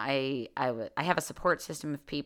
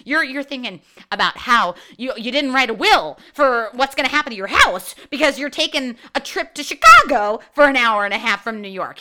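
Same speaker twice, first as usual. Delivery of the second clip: loud, heated rant; getting worked up and louder; fired up; 5 semitones higher, 8 dB louder than usual. Real, not acted. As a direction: passionate ranting outburst. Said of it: "Here I am getting all fired up".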